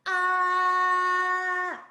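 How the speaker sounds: long, high, loud "Ah," with no pitch movement; even level tone. Shown as a demonstration. Teacher shows the class the first tone, an even "Ah,". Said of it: "It's a very even sounding, um, even tone".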